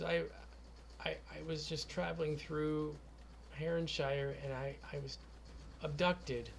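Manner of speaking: quiet, drained voice; weak captive, barely getting words out; tired and weak. Acted. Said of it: "she's extremely tired and, uh, weak".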